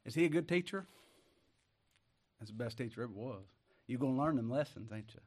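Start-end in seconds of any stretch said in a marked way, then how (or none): none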